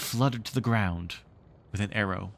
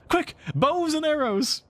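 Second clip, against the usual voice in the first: high, loud call to arms; urgent boy; 4 dB louder than usual. Acted. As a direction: hurry the others. Boys rush to shoot.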